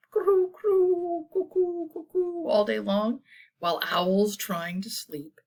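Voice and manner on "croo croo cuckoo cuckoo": high coos and cuckoo calls; bird calls. Acted. Reader summing up birds up all day, cooing. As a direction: call high like doves and cuckoos.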